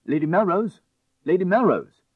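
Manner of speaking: loud high calls through a doorway; calling out. A narrator's reading. Crowley calling to deaf Lady Melrose, loud calls. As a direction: call her name loudly.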